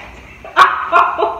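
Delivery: loud airy burst of laughter; breathy laugh. Real, not acted. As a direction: laugh hard through the breath. Laughing at the twelve joke.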